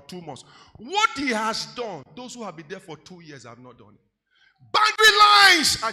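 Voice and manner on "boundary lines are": loud. Real, not acted. Preacher peaks in sermon.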